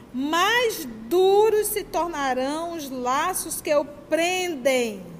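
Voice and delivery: slow, weighty reading of the quote, stretching each word; slow emphatic reading. Shown as a demonstration. Preacher reading a quoted passage to the audience, slow and weighty. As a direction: read it out slowly so every word lands. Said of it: "Olha a palavra".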